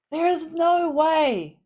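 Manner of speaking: real outraged shout at the TV; shouted disbelief. Real, not acted. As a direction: yell it at the screen, refusing to believe. Disbelief at a character's death.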